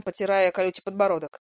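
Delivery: ordinary delivery, nothing marked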